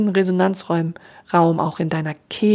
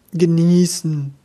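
savouring with a laugh; slower than usual. Shown as a demonstration. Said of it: "damit verbinden wir auch sowas wie Genuss".

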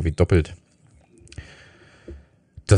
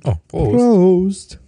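cheering Prost, pitched way up; excited toast; 12 semitones higher, 4 dB louder than usual. Real, not acted. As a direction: raise the glass and cheer. Toasting with beers.

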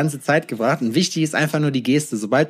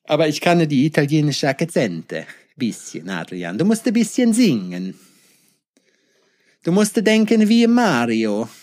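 Super Mario Italian. Pretend. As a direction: think like Mario and sing a little. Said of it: "zu Super Mario-lastig". (wie Super Mario mit italienischem Akzent, etwas höher)

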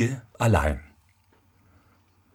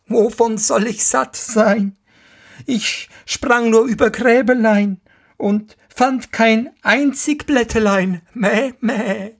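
hoch und meckernd wie eine Ziege, klagend; meckernde Ziege; 13 semitones higher than usual. Acted. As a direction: die freche Ziege jammert dem Vater etwas vor. Die Ziege antwortet. (hohe, meckernde Ziegenstimme)

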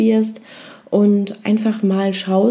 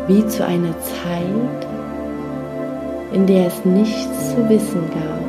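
guided meditation; 3 dB quieter than usual. Acted. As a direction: lull them gently into rest.

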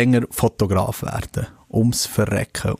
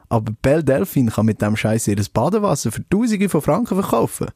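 usual speaking voice

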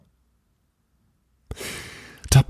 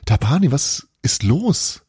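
calling out; 7 semitones higher than usual. A narrator's reading. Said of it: "rief er".

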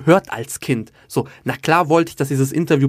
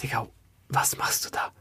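hushed, half-whispered self-doubt; irrational anxious thought; 3 semitones lower, 9 dB quieter than usual. Retold or imitated. Comedian voices his own panicky thought before an interview, anxious and hushed. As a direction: drop to a near-whisper, questioning yourself. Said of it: "irrationale Angst, wo du dir denkst".

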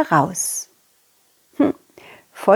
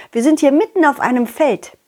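plain reading voice

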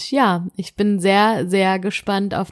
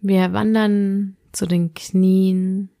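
sleep meditation; slower than usual. Acted. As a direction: guide the listener gently into sleep.

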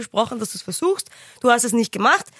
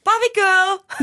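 excited answer; 9 semitones higher, much slower than usual. Shown as a demonstration. Answering the challenge to name another Aqua song, excited. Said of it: "nenne einen anderen Aqua-Song außer Barbie Girl".

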